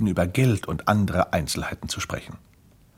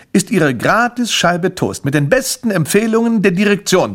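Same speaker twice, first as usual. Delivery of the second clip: high, loud official flourish; pompous presentation; 8 semitones higher, 6 dB louder than usual. Acted. Grimpel hands over the free sample, loud pompous salesman. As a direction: present it grandly on behalf of the management.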